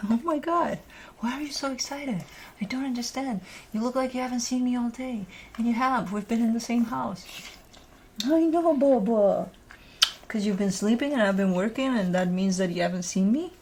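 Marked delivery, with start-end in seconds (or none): 0.1-9.5 higher-pitched doting pet voice; high, cooing mock puzzlement. Real, not acted. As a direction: fondly tease an excited dog. Teasing baby talk to excited dog.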